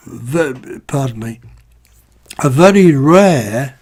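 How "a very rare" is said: "very rare" pushed out louder, each word leaned on; stress on very rare. Real, not acted. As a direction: punch "very rare" so no one misses it. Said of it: "and I must stress the words very rare".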